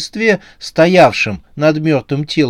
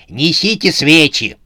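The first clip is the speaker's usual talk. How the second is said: commanding order; 4 semitones higher than usual. A narrator's reading. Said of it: "приказала она".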